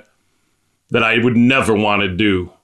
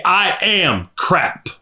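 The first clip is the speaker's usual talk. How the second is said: high, drawn-out, falling confession; emphatic self-abasement; 7 semitones higher, much slower than usual. Real, not acted. Preacher confessing his wretchedness to the congregation, emphatic. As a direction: stretch each word out high, then drop.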